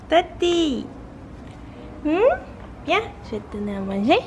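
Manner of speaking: high soft coaxing pet voice; pet talk to cat. Real, not acted. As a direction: coax the cat gently. Calling the cat to eat. (voix douce pour appeler le chat)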